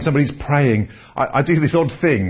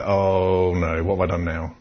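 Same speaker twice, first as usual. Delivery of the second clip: dismayed aside; 6 semitones lower, 4 dB quieter than usual. Retold or imitated. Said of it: "And I was like".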